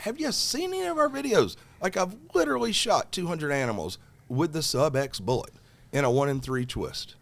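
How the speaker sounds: loud, high, incredulous comeback; exasperated retort. Retold or imitated. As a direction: fire it back at him, disbelieving. Retelling heated argument with rep.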